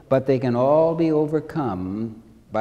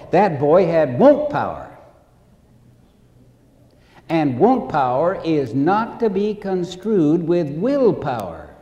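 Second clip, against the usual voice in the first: normal talking voice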